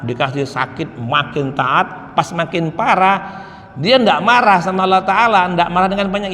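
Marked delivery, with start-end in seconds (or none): 1.0-2.2 slow refrain. Real, not acted. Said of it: "repeated refrain, slowed".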